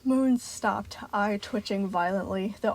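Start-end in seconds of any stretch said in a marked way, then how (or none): none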